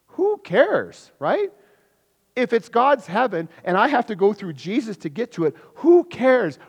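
high, loud mock dismissal, hammed up; mocking who cares. Preacher mocking the world's shrug, loud and scornful. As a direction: throw it out like the crowd shrugging.

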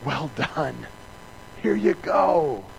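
awed and affectionate; a hint of God moved by it; tender praise. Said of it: "He says".

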